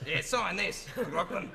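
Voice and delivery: a light take on a blunt, demanding fan, laughing; brusque and offhand, with a laugh; mimicking a rough Australian fan who demands an autograph, blunt; pushy Australian fan. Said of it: "In Australia, they give me an autograph or else".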